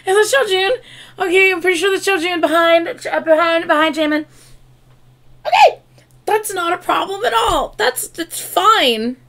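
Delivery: high, loud flustered gushing; barely holding it together; squeaky excited fluster; giddy fangirl excitement. Real, not acted. Her bias appears.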